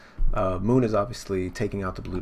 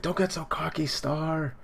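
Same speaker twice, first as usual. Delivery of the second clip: high-pitched mock taunt; hammed-up defiant comeback; acted scornful retort; 8 semitones higher than usual. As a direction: snub a rival in a pitched-up voice. Acting out character's retort to rival.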